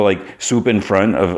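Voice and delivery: ordinary talk, nothing marked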